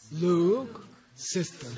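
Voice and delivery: drawn-out very slow, each syllable spaced; slow read-along. Shown as a demonstration. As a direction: lead it slowly for the class. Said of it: "do it in Tibetan and then do it in English".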